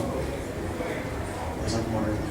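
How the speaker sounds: whispered, slow and soft. Acted. Repeats line, drops to whisper.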